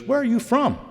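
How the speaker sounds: high-voiced cashier asking where he's from. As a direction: pitch it way up as the cashier asks. Cashier quoted, much higher.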